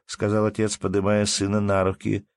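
ordinary delivery

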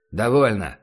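barked. Acted. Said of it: "рявкнул легионер".

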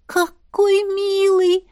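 high, very slow gush of delight; rapturous high-pitched drawl; delighted, drawn out. A narrator's reading. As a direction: stretch it out, cooing over a cute animal. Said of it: "восторженно протянула Вероника".

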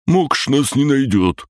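high, slow creature voice; high-pitched, slow creature grumble; green creature mumble. A narrator's reading. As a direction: drag it out in a high put-on voice. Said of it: "пробубнил зелёный".

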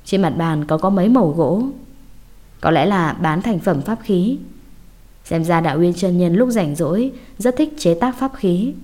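usual speaking voice

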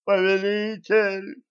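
high, slow mournful wail; grieving howl. A narrator's reading. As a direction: wail it in despair. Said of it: "горестно завыл бледный". (горестный вой)

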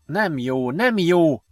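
helpless, rising on the repeat; mildly flustered, at a loss; helpless muttering. A narrator's reading. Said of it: "mondogatta Ben tanástalanul".

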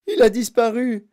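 high, loud cry of alarm; howling. A narrator's reading. Mother sees her son vanish, howling. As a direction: scream it in panic. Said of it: "hurla Madame Gloop".

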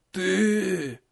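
terrified, shaky whispered gasp; trembling whisper. A narrator's reading. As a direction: breathe it out in terror. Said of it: "дрожащим голосом прошептал он".

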